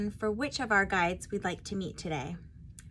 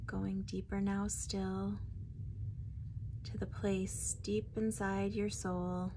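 guided meditation; 5 dB quieter than usual. Acted. Guide leading listeners to meet their spirit guide, guided meditation. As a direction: ease them inward, phrase by phrase, unhurried.

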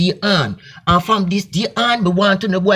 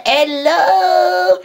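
wailing call; 10 semitones higher than usual. Acted.

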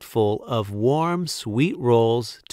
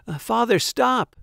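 urgent shout; 11 semitones higher than usual. A narrator's reading. Son stops father dumping dough, urgent shout. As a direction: cry out to halt him. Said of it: "shouted Walter Junior".

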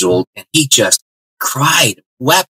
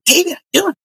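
lightly voiced young woman, much higher; Susan greeting; faster than usual. Susan greeting David, lightly voiced much higher. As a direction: pitch it up for a friendly girl's hello.

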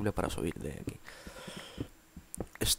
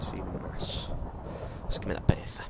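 whispering. Real, not acted. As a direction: whisper it lazily to yourself. Unvoiced aside while playing.

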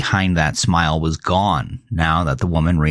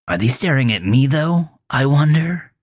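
sly teasing lilt, rising high at the end; taunt; 7 semitones higher than usual. A narrator's reading. Melvin taunts Gavin from the car roof, sly rising lilt. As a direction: toss the question back slyly. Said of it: "It was a taunt".

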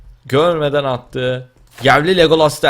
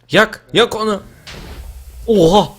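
shout; 7 semitones higher, 3 dB louder than usual. Real, not acted. Shouting during a fight.

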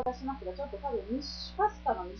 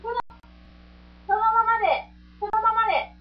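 calling out; 9 semitones higher, 9 dB louder than usual. Real, not acted. Calling out to keep still.

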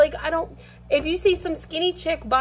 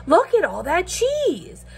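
higher, slower fake awe at tiny cheese; mock amazement; 7 semitones higher than usual. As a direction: ham up being amazed by little pieces. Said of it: "but I'm like".

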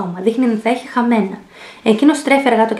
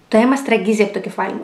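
ordinary delivery, nothing marked

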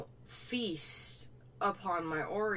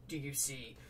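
softer, lower teasing question; quiet aside; 6 semitones lower, 7 dB quieter than usual. Acted. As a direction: drop it to a sly aside. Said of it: "Missus Leeds".

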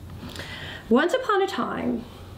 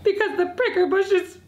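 high, halting tearful voice; upset; 9 semitones higher than usual. Acted. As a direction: stammer toward a high, weeping wail. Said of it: "I'm upset because".

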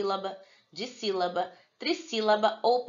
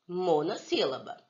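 ordinary talk, nothing marked

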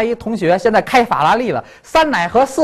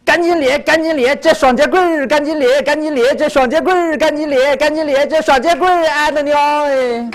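high, insistent Shandong-accented chant; Shandong dialect; 7 semitones higher than usual. Shown as a demonstration. Showing a child the song in Shandong dialect, Shandong accent. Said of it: "山东话".

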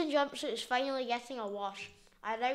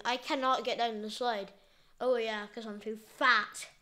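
ordinary talk

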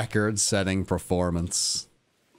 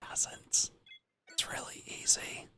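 very soft, voiceless whisper; hushed, barely-there aside; 12 dB quieter than usual. Real, not acted. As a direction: whisper it under your breath. Drops to a whisper after outburst.

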